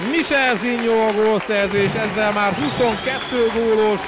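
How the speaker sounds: huge shouted goal call; excited Hungarian sports commentary. Acted.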